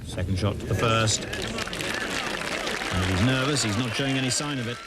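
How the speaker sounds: hushed live golf commentary; golf commentator. Real, not acted.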